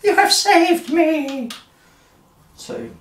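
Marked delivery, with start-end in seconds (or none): 0.0-1.5 high-pitched, hammed-up loving gratitude; adoring Freya. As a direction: gush like a rescued damsel, building louder. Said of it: "looks at him all lovingly and goes".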